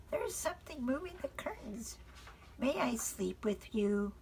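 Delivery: high, small, pleading voice; a frightened child asking for comfort; scared little girl. Acted. Frances begging Father to let her sleep with him.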